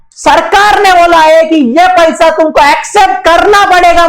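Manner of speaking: loud, high declaration of the rule; government's decree. Retold or imitated. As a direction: announce the law like an official order. Teacher voicing the government's order.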